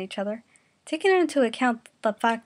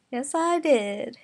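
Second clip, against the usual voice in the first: smug comeback; 3 semitones higher, much slower than usual. Acted.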